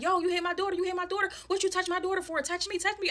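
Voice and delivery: high-pitched angry challenge; imitated confrontational mother. Mother confronting the woman over her daughter, heated and high. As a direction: mimic an irate mom squaring up.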